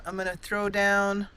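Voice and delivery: ordinary talk, nothing marked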